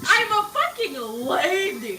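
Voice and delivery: high loud mock protest; indignant. Real, not acted.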